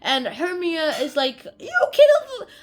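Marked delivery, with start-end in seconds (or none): 1.6-2.5 very high, loud hammed-up cry; mock accusation. Hermia accusing a man of killing, mock outrage. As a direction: squeal a comic accusation.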